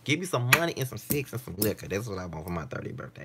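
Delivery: ordinary delivery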